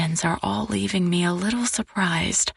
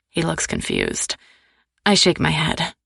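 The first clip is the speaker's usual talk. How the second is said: ordinary delivery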